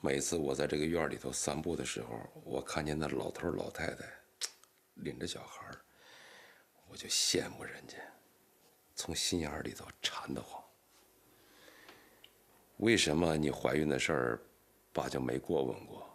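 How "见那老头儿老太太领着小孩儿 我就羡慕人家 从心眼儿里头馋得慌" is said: soft, whispered longing; hushed yearning; wistful whisper. Acted. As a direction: confide it in a hush. Father wistfully confides.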